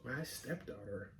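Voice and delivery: quiet fast muttering to self; muttering reread. Real, not acted. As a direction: mumble it under your breath.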